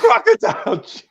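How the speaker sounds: high, loud and laughing; cracking up at the nickname; laughing echo. Real, not acted. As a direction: burst out laughing on the name. Laughing at friend's joke.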